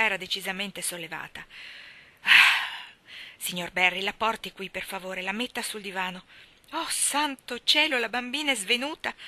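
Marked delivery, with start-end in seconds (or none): 2.2-9.1 loud gasp then high panicked plea; alarmed cry. A narrator's reading. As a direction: cry out as the child faints. Marilla alarmed, child faints. (Marilla vede Anna svenire, grido allarmato)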